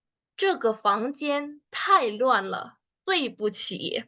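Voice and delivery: slow, even demo read; slow clear model sentence. Shown as a demonstration. Teacher models the apology sentence slowly for students. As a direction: say it slowly for learners.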